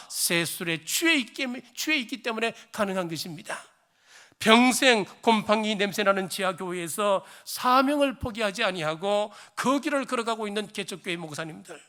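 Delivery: loud, soaring sermon delivery; fervent preaching. Acted. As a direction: preach it out to the whole congregation. Sermon continues.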